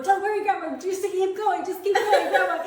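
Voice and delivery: put-on high, loud, eager little-kid pleading; small child begging grandma to keep going, laughing; excited child urging. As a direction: cheer grandma on like a three-year-old.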